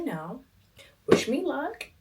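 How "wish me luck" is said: high bright sign-off; excited. Real, not acted. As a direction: toss it off cheerfully.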